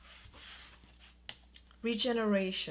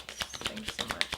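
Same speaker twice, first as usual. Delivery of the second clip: whisper. Real, not acted. Reader whispering thanks to her guides. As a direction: breathe the thanks privately.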